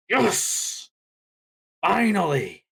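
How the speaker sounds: high, drawn-out fan cheer; long, high-pitched exclamations of joy; elated cheer. Retold or imitated. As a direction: re-enact a thrilled fan's relief. Acts out own elated reaction.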